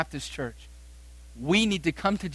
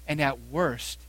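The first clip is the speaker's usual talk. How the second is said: usual speaking voice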